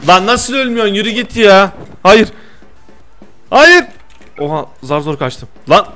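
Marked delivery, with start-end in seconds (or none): 2.0-3.9 sudden high yelp of no; panicked shout. Real, not acted. Gamer shouting no as a rival fires, high panicked yell. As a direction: cry out as you get hit. Getting shot in game.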